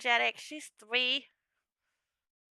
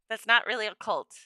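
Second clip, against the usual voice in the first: ordinary delivery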